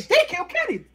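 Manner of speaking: high, emphatic outcry of the line; South Park outcry. Shown as a demonstration. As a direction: cry it out like the famous South Park moment. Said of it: "But they didn't say".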